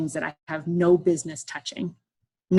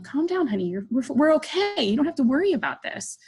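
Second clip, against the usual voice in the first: higher-pitched, placating reassurance; soothing a spouse; 7 semitones higher than usual. Retold or imitated. Wife to stressed-out husband in the clinic, soothing. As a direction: talk a stressed partner down in a raised, placating voice. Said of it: "He's, like, stressing out".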